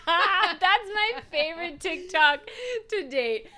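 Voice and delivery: laughing hard through the words; words bursting out in laughter. Real, not acted. Laughing at Dr Phil clip.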